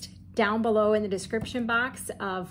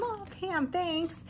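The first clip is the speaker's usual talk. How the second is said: high, soft thank-you; touched thanks; 8 semitones higher, 4 dB quieter, slower than usual. Real, not acted. Thanking Pam for the card, high and soft. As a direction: melt a little as you say her name.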